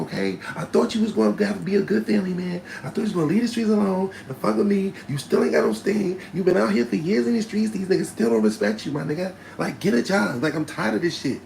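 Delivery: high, loud telling-off; hammed-up furious girlfriend; angry girlfriend. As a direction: kick him out as his girl.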